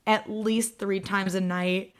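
usual speaking voice

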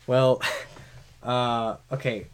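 ordinary delivery

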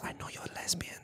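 unvoiced whisper in her ear. Stranger whispering to the runner, secretive whisper. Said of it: "She goes".

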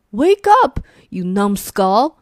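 grumpy monster yelling the other awake; shouted. Acted. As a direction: bellow him awake. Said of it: "he shouted".

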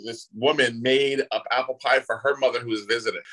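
ordinary delivery

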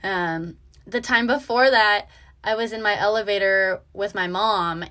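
usual speaking voice

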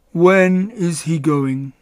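slow, drawn-out gloomy donkey; gloomy Eeyore. A narrator's reading. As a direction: drag the question out gloomily. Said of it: "was always gloomy".

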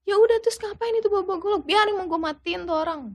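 high-pitched imitation of an angry mother; retold enraged mother. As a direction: voice her defiant threat without shouting. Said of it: "mamaku langsung naik pitam".

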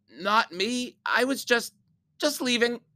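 high, frightened stammer; panicked. Acted. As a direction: squeak it out, scared stiff. Terrified kid stammering excuse.

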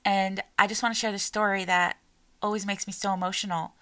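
usual speaking voice

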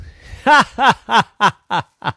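high, loud ha-ha-ha; acted laugh. Boy cracking up at his joke, performed laughter. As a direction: laugh big at your own joke.

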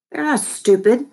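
high-pitched, indignant demand. A narrator's reading. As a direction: demand an answer, voice jumping up. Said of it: "I demanded".

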